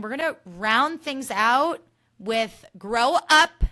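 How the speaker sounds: hosting a podcast segment intro; segment announcer. Acted. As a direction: announce the next segment with a show-host flourish.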